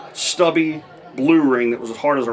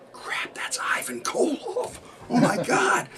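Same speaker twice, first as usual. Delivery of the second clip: whispered, high and laughing under the breath; stunned laughing whisper aside; panicked whisper; 12 semitones higher, 7 dB quieter, slower than usual. Retold or imitated. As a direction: hiss it in disbelief, half laughing, so he can't hear. Panicked aside, phone on hold.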